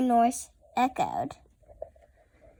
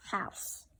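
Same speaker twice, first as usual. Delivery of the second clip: whisper; 11 dB quieter than usual. Acted. Child reading, whispers final word.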